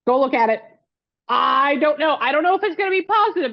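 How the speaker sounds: high-pitched eager rush; excited urging. Real, not acted. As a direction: push her to go check, all nerves and hope. Urging friend to check test.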